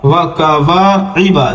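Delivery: slow, stretch the alif; slow drawn-out reading. Shown as a demonstration. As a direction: read it slowly, drawing out the long vowel. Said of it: "الف داخل ہے تو تھوڑا سا کھینچا جائے گا". (آہستہ، الف کھینچ کر)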